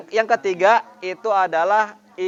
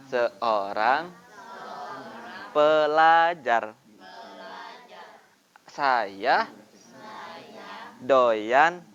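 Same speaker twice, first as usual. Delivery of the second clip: slow, lightly lowered big voice; first voice for storytelling; 4 semitones lower, much slower than usual. Shown as a demonstration. Teacher demonstrates the big voice to children, slow and low. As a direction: read it slowly like a big character. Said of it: "Suara pertama, suara teman-teman seperti ini".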